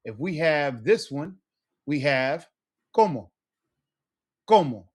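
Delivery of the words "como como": "como" with a loud CO, falling away on mo; first-syllable stress. Shown as a demonstration. Teacher showing the stress rule, CO in "como" stressed. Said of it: "more emphasis on that first part".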